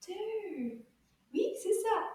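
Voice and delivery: usual speaking voice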